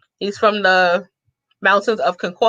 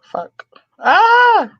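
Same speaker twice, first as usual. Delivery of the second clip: sudden loud high cry of alarm; real startled shout; 12 semitones higher, 5 dB louder than usual. Real, not acted. Realizing the wrong screen is showing, startled yelp.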